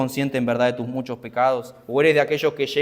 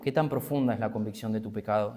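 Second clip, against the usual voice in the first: "tan" kept stressed, the whole question softer and lower; quieter repeat; 4 semitones lower, 7 dB quieter than usual. Real, not acted. Preacher repeats his question to the congregation softly. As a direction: ask it again softly, leaning on "tan". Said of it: "Y yo te pregunto".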